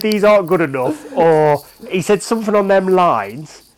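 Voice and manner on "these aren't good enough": retold manager's verdict. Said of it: "I can't remember if he said".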